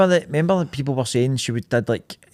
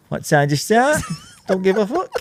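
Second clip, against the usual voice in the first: light mock Bolton accent; Peter Kay; 5 semitones higher than usual. Passenger chatting to taxi driver in a light Peter Kay accent. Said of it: "in a Peter Kay accent".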